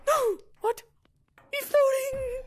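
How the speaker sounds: very high, loud shriek of disbelief; shocked scream. Real, not acted. Seeing another player float, a shocked shriek.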